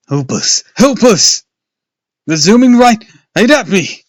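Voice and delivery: loud, very high wailing; panicked wail. A narrator's reading. Luke wails as bats zoom at him. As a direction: wail it in rising terror. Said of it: "Luke wailed".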